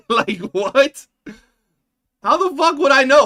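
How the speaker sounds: loud, high, disbelieving yell; exasperated shout. Real, not acted. Streamer yelling back at a chatter who accused him, exasperated shout. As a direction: blow up at the absurd accusation.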